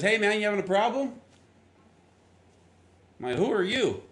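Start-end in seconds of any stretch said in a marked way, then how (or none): 0.0-1.1 high, quick, louder hail; imitated helpful stranger. Stranger to stranded driver, imitated friendly hail. Voices stranger offering roadside help. 3.4-4.0 high-pitched puzzled question, imitated; mock-bewildered challenge to a stranger; retold bewilderment. Stranded driver to unknown helper, retold bewilderment. Retells startled reply to stranger.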